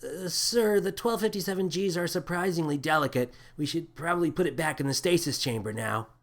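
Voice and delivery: meek subordinate warning his captain; hint of a timid junior officer; timid crewman. A narrator's reading.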